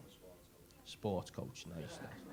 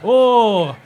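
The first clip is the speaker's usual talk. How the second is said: ordinary delivery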